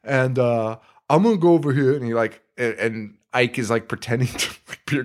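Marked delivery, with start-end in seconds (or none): 0.0-1.9 lightly voiced goofy guy. Brother directing the girl to the park bench, lightly voiced goofy guy. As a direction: play the eager dim brother setting up the bench. Retelling the guys' scene in the movie.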